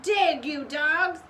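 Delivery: loud, slow barked order; shout. A narrator's reading. Pirate captain orders his men, slow loud shout. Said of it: "he shouted".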